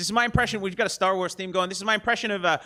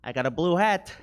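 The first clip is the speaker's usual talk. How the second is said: Robert De Niro with head injury; 2 semitones lower than usual. Pretend. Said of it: "Robert De Niro with a severe head injury".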